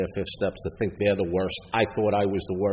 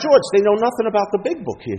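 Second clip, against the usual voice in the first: high, louder mock outrage; indignant complaint; 12 semitones higher, 7 dB louder than usual. Newcomer complaining to sponsor at meeting, high-pitched indignation. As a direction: protest like an aggrieved newcomer.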